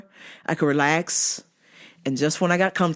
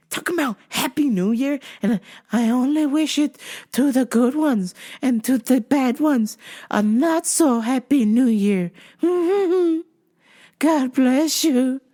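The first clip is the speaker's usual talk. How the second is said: creepy stalker; 6 semitones higher than usual. Retold or imitated. Worker mocking the stalker's New Year blessing, creepy coo.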